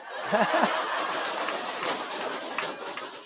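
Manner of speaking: a long, airy, unvoiced laugh; हवा भरी लंबी हँसी; breathy laugh. Real, not acted. Punchline: he's from Karachi. (बिना आवाज़ के खुलकर हँसना)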